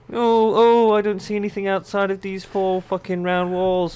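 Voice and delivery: high, whiny mockery of the wizard; high mocking impression of the old wizard. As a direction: sneer by playing him a coward.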